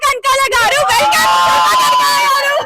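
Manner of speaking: loud, high, drawn-out welcome call; excited welcome. Real, not acted. Host hyping the crowd for Suma, loud slow welcome. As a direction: announce her like a star arriving.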